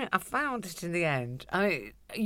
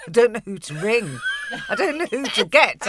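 panicky; 6 semitones higher, 7 dB louder than usual. Retold or imitated. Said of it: "I'm thinking".

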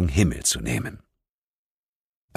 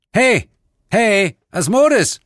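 loud, slow call upward; 12 semitones higher, 6 dB louder, slower than usual. Acted. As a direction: call the name across worlds. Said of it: "rief ich deshalb den Namen". (laut rufend)

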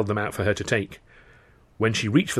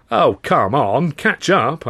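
laughing; 5 semitones higher, 5 dB louder, much slower than usual. A narrator's reading. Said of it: "I laughed".

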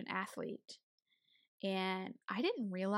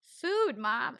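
high, loud answer to mom; mock exasperated retort; 4 semitones higher, 7 dB louder than usual. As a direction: imitate a college kid stating the obvious to a parent. Retold retort to mother's money question.

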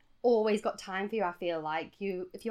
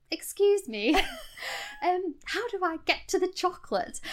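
high, polite British request; 10 semitones higher than usual. Retold or imitated. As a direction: ask in a very British voice, pitched up. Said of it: "I've never felt more British".

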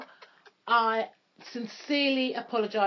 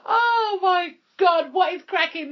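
hyped intro; 9 semitones higher, 7 dB louder than usual. Real, not acted. Loud podcast intro hype.

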